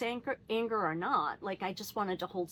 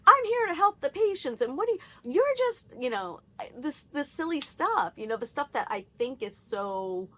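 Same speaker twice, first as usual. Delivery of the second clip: much higher and louder, self-righteous mock rant; 6 semitones higher, 5 dB louder than usual. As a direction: parody my own preachy outrage. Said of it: "being righteous".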